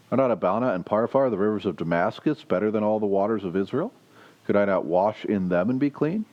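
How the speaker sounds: reads the Bible passage aloud to the congregation; measured, lightly lifted reading voice; scripture reading. Acted. Preacher reading the passage aloud to the congregation.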